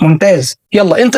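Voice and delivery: usual speaking voice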